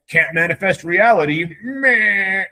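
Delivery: hammed-up whine ending in a bleat; mock whining. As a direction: imitate a sulky complainer. Mocks people complaining about reality.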